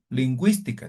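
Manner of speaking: "lingüística" with gwi, the w heard; u pronounced in GÜI. Shown as a demonstration. Teacher reading the corrected word, "lingüística" with sounded u. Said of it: "esta U lleva diéresis".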